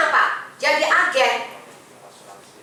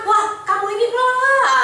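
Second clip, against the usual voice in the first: angry customer; 9 semitones higher than usual. Retold or imitated. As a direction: imitate the customer ranting at you, half in jest. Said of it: "dia marah-marah". (menirukan nasabah yang marah-marah, nada tinggi)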